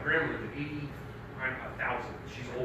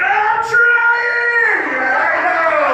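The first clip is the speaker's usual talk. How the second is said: high, loud straining cry; strained mock shout; 12 dB louder, much slower than usual. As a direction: yell it as if pushing with everything. Voices struggling body part pleading.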